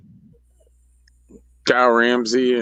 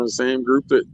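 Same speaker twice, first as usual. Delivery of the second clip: ordinary talk, nothing marked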